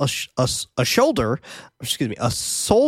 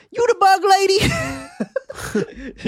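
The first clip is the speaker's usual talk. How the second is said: put-on New York accent; much slower than usual. Host quoting kids who stop Susan, exaggerated New York accent. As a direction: stretch it out high in a brash New York accent. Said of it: "shout out to New York accents".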